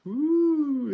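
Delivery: impressed ooh. Real, not acted. Reacting to cool name.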